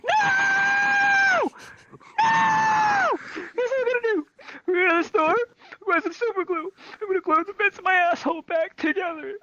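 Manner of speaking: very high shriek easing into frantic squeal; hysterical screaming. Retold or imitated. Hurt kid refusing the hospital, hammed-up high hysterics. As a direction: scream the noes, then squeal out the plan. Claims calm, screams at buddy instead.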